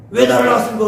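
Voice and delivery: loud, high-pitched mock confrontation; acted outcry. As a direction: demand an answer with a burst of volume. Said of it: "소리치는 게 낫겠죠". (큰 소리로 따져 묻는 흉내)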